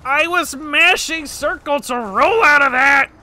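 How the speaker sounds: high, loud indignant shout; outraged yell. Real, not acted. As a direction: protest loudly at the game. Outraged at the failed roll.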